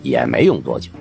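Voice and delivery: plain reading voice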